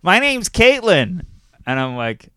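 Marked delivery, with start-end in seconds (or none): 0.0-1.3 put-on high, slow child's voice; little girl voice. Comic quoting the little girl from the crowd, high and slow. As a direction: play the tiny girl introducing herself. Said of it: "the girl goes".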